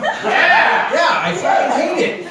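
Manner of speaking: a laughing shout of agreement; excited yell. Real, not acted. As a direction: burst out yes, I hate it. Excited outburst about Oxford comma.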